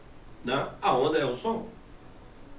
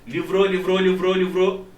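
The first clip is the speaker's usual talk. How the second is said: LIVROU chanted evenly, swelling louder toward the end; rising intensity; 4 dB louder than usual. Shown as a demonstration. Teacher showing reverberation intensity increasing, swelling chant. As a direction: loop LIVROU on one pitch and push the volume up. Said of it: "você já vê que a intensidade aumenta".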